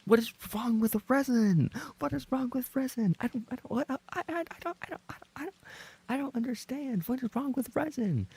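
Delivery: high, flustered, stammering squeal; high indignant squeal. Real, not acted. Streamer defends the word resin, high flustered squeal.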